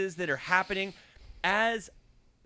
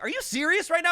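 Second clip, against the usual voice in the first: much higher disbelieving question; incredulous; 11 semitones higher, faster than usual. Retold or imitated. Narrator stunned at the driver, a high question. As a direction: ask it high, amazed. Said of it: "I was like".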